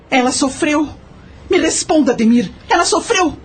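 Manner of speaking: high, pained pleading, close to tears; anguished pleading. Acted. Aunt begging Ademir to say if Julieta suffered, anguished. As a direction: beg him for the truth, voice strained high. Zefa begs for the truth.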